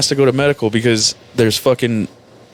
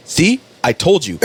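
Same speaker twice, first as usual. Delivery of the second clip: mock vindicated husband; 7 semitones higher than usual. Marine quoted speaking to his wife.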